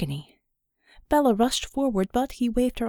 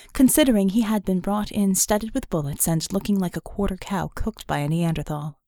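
ordinary talk, nothing marked

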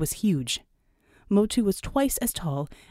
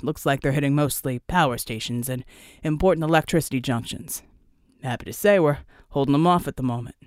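low squad leader's briefing; team lead Flack; 5 semitones lower than usual. Acted. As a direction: play the team lead reporting, pitched well down.

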